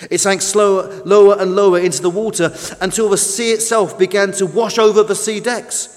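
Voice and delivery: ordinary delivery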